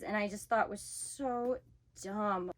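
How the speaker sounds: normal talking voice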